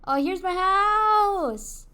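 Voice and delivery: loud, high, drawn-out exclamation; delighted shout. Real, not acted. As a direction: call it out and stretch the last word. Calls out on finding house.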